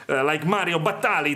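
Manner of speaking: usual speaking voice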